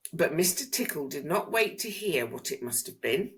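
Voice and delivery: ordinary delivery, nothing marked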